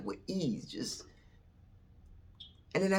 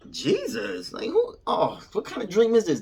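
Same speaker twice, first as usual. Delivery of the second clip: high-pitched, incredulous retelling; startled disbelief; 12 semitones higher, 4 dB louder than usual. Retold or imitated. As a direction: balk at the crazy dream in a high voice. Acted startled reaction to Jesus.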